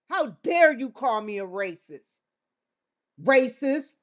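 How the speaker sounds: usual speaking voice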